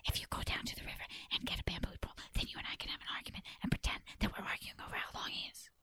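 quick, secretive whisper; whispered plan. Acted. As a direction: whisper it fast into an ear. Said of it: "she whispered a plan in his ear".

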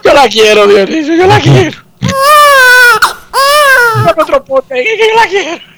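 high, hammed-up lovesick wailing; mock crying. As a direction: cry out for her like a drunk at the bar. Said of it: "yo no estoy llorando".

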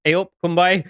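put-on northern English greeting; playful mock northern English accent. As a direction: imitate a Northerner's dialect. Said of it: "they do talk like that".

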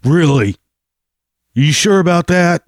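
mock-amazed, pitch up, dripping sarcasm; sarcastic. Real, not acted. Vlogger mocking a celebrity PSA, sarcastic. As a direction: sneer back at the celebrities in fake awe.

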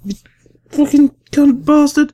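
playful outraged insult; swearing tirade. Pretend. Mock insult to co-host.

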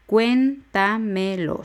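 "Cuéntamelo" slowly split as cuén-ta-me-lo; syllable by syllable. Shown as a demonstration. Teacher counting four syllables for learners, slow syllable-by-syllable "Cuéntamelo". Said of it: "Son cuatro sílabas".

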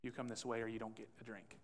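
a lion speaking low and unmoving; quiet lion voice. Retold or imitated. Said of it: "he says what God says".

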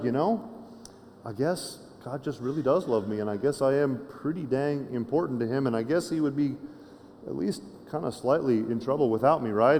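low, soft, as if thinking aloud; private self-assured musing. Acted. Churchgoer privately assuming God needs him, quiet musing. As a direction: muse quietly to yourself.

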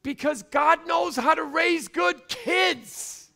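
loud, slow, high preaching cry; full-force preaching. Acted. Preacher rousing the congregation, loud and slow. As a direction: preach it out to the whole room.

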